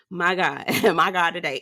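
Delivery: normal talking voice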